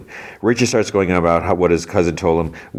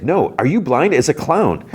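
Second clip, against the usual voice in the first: much higher-pitched indignant protest; exasperated retort; 9 semitones higher than usual. Retold or imitated. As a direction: imitate a girl arguing in disbelief. Lily argues with dismissive chief.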